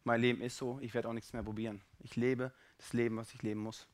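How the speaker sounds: low, soft voice of giving up; quiet, defeated acceptance; resigned. Acted. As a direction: say it as someone who has quit trying. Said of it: "dass du sagst".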